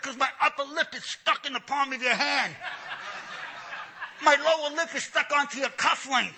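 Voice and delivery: high-pitched exasperated whine of complaint; comic distressed protest. Retold or imitated. Man caught in pastor's hand, high comic protest. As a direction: plead as if stuck fast.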